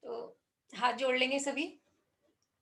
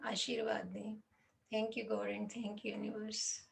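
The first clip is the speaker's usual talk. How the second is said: slow, soft, lowered prayer voice; reverent prayer; 3 semitones lower, 5 dB quieter, much slower than usual. Real, not acted. Meditation teacher asking God and the universe for blessings, slow hushed prayer. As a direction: pray softly, pausing after each plea.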